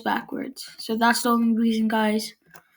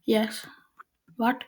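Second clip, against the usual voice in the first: usual speaking voice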